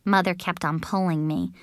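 ordinary delivery

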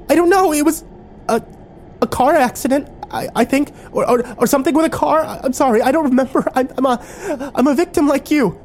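very high, stammering, panicked pleading; scared. A narrator's reading. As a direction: plead for your life, stumbling over words. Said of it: "Gone was the scared".

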